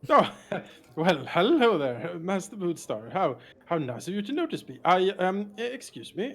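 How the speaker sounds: loud, theatrical showman greeting; Volo voice. Acted. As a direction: boom out a flustered, flowery hello.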